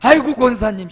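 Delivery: demo big greeting. Shown as a demonstration. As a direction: show them how to greet with gusto. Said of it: "옆에 사람 가면 실습해 봅시다".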